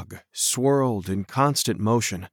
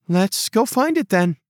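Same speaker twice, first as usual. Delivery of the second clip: lightly voiced girl; 7 semitones higher than usual. A narrator's reading.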